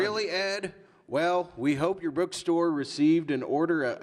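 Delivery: high, loud mock southern accent. Stan replying to a fan's letter, southern accent. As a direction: answer in a hammed-up southern voice. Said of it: "I'm giving a southern accent".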